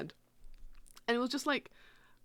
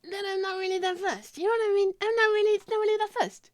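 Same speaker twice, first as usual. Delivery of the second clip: high, loud, feigned unbothered voice; mock nonchalance; 11 semitones higher, 4 dB louder than usual. Pretending not to care about the bee, high and loud. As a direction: fake not caring to trick fate. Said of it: "let me reverse psychology".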